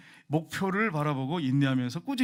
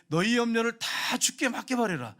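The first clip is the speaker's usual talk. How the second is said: higher, slow preaching restatement; emphatic paraphrase; 6 semitones higher, 3 dB louder than usual. Real, not acted. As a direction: drive the verse home. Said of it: "같이 읽겠습니다". (높고 느리게 힘주어 풀어 말하기)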